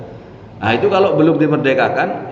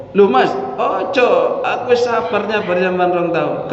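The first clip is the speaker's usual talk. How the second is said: pleading wife; 9 semitones higher than usual. Retold or imitated.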